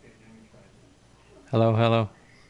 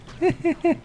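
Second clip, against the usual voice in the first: squeaky laugh. Real, not acted. Laugh after monster line.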